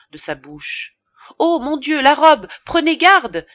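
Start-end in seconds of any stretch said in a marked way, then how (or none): 1.4-3.5 high, loud startled shriek; alarmed cry. A narrator's reading. Innkeeper shrieking as the dead woman's dress is soiled. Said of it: "s'écria madame Lefrançois".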